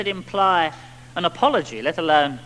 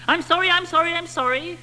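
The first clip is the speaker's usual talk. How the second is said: put-on high, pleading little boy's sorry; 아이처럼 높은 목소리로 다급하게 빌듯이; child's pleading; 12 semitones higher than usual. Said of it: "the little boy shouts".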